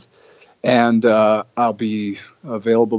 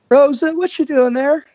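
much higher, louder put-on Arn voice; Arn impression; 4 dB louder than usual. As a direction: ham up the tough guy. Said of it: "everybody has their own Arn impersonation".

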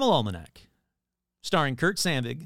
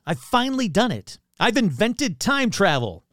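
triumphant; 6 semitones higher, 5 dB louder than usual. Acted. Acting his triumphant discovery.

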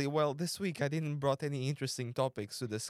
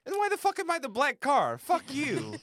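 mock outrage; 10 semitones higher, 5 dB louder than usual. Indignant mock outrage at friend.

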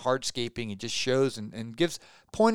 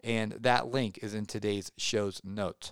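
usual speaking voice

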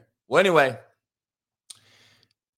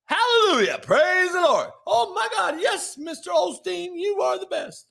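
much higher, gushing mock believer; pitched-up mock fan of the preacher; gushing worshipper; 14 semitones higher than usual. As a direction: squeal praise like a starstruck churchgoer. Mock worshipper praising the pastor.

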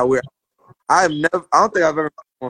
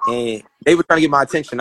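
ordinary talk

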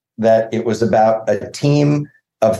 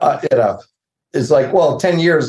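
usual speaking voice

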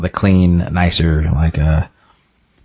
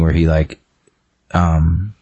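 ordinary talk, nothing marked

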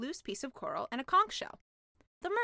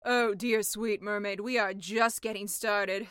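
slow, louder knowing witch; patronizing sea witch; 2 semitones lower, 6 dB louder, slower than usual. Acted. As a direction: savor it, sweet mermaid. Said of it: "said the sea witch".

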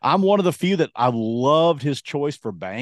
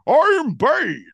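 high, loud cartoon British villain; Bane; 14 semitones higher, 6 dB louder, much slower than usual. Imitating the cartoon villain, loud British-Australian accent. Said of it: "like, a British or an Australian voice".

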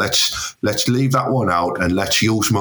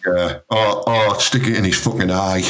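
usual speaking voice

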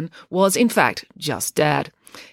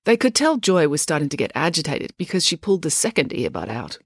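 ordinary delivery

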